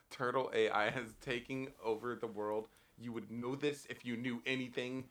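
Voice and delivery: ominous deep voice. Pretend. Echoes, slower, deeper.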